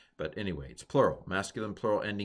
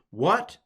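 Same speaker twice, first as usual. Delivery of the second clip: high, loud questioning What?; punchy rising What?; asking What; 8 semitones higher, 5 dB louder than usual. Shown as a demonstration. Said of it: "It answers the question".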